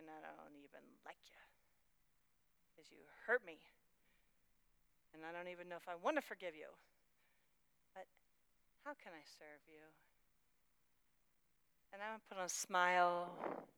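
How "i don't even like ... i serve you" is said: much softer, bitter inner thoughts. Acted. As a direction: mutter the resentment under your breath. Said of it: "I'm thinking".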